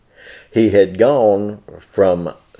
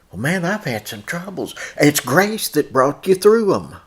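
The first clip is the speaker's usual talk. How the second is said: full-force preaching; 6 semitones higher than usual. Acted. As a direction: preach it hard to the brethren.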